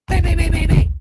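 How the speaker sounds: loud chanted bang bang; shouting out the door knocks; loud banging imitation. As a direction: pound out the knocks with your voice. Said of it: "Sorry, that's loud".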